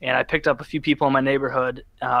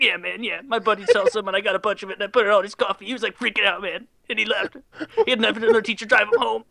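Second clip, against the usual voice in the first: put-on higher, excited stoner kid voice; imitating Mike; 6 semitones higher than usual. Mike bragging about his acid prank, higher hyped voice. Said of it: "and he talked like that".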